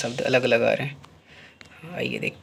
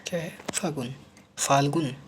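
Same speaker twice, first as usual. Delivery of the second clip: usual speaking voice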